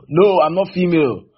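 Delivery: loud, defiant objection; insist, shouting you're male; a mock angry shout of denial; indignant insistence. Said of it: "then it means you are female".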